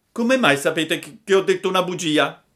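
high puppet voice, flustered; a caught little liar, quickening. Acted. Pinocchio caught lying. (burattino colto in fallo, voce alta)

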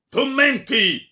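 very high, loud, drawn-out roar. A narrator's reading. Baron interrupting the friar's oath, roaring. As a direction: roar it over the other speaker. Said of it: "ruggì il barone".

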